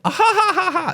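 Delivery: obnoxious high-pitched cackle; Ricky Gervais. Retold or imitated. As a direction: mimic a very high, staccato ha-ha-ha. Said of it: "His obnoxious laugh".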